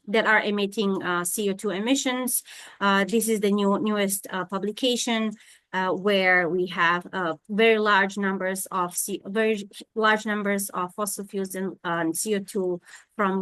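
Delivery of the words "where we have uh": ordinary talk, nothing marked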